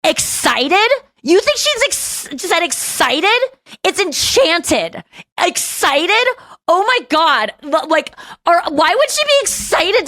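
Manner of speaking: high, slow mocking disbelief; mock the wrong lyric in disbelief; retelling a scoff at a friend's snide correction; incredulous. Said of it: "I said to him".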